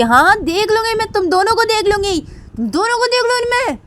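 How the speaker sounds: very high, loud yell; full-volume, high-pitched angry shouting; shouted threat. Acted. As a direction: scream the threat after them. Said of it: "ऐसे चिल्ला के बोल देती".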